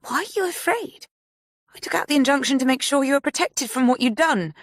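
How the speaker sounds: calm menace, talking down to her; voiced Sadie, cold. A narrator's reading. Sadie coldly questioning Mia on the call.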